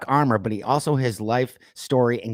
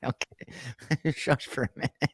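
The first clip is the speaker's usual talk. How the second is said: soft words squeezed through laughter; helpless laughing; 10 dB quieter, slower than usual. Real, not acted. Host shushing the joking co-host, laughing softly. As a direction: laugh while trying to hush him.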